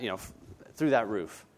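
normal talking voice

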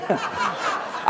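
soft breathy laugh; a quiet, unvoiced chuckle. Real, not acted. Laughs at the tale.

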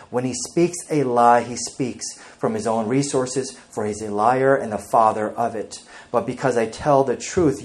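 loud, emphatic Bible reading from the pulpit; scripture reading. Acted. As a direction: proclaim the verses to the congregation. Reads scripture aloud.

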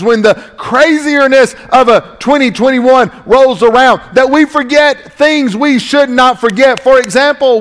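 high, measured, punched pulpit phrases; ringing, emphatic sermon cadence; full-force preaching. Real, not acted. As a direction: drive each phrase home to the congregation. Preaches with raised voice.

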